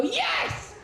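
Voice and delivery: very high, ecstatic cry; hammed-up congregation response; mock crowd cheer. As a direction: shriek it like a new convert. Crowd leaps up to answer.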